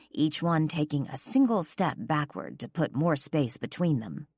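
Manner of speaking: ordinary delivery, nothing marked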